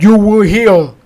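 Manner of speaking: loud, slow mock-healer pronouncement; snake oil charmer. Telling a rabbi friend about the healer, loud portentous imitation. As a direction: imitate a healer proclaiming, words stretched out. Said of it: "this snake oil charmer".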